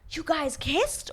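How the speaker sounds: high-pitched, drawn-out disbelief; mock loud shock. Joao learning of a kiss, high mock shock. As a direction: imitate an overreacting man, pitch leaping up. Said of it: "he's just a loud man".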